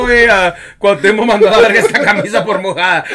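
loud, high, laughing joke; laughing through words. Real, not acted. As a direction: laugh as you read the mock label. Laughing mock certificate line. (riendo mientras bromea)

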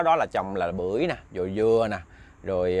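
usual speaking voice

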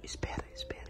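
hushed whisper. Real, not acted. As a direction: whisper so he stays asleep. Whispering near sleeping boy.

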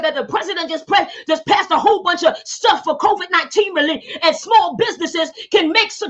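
fervent high preacher's cadence mixed with tongues; preaching. Real, not acted. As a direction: bind up fear with force.

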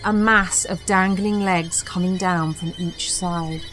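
usual speaking voice

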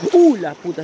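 startled curse. Real, not acted. Water suddenly deeper, swearing.

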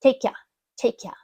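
"Take ya" with your reduced to "ya". Shown as a demonstration. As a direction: say "Take ya", never the full your. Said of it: "your читається як я".

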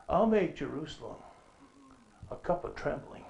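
ordinary talk

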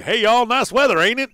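loud, high, hammed-up Southern accent; Larry the Cable Guy. Player showing how it should sound, loud Southern accent. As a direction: holler it in a broad country Southern accent. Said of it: "Larry the Cable Guy, right?".

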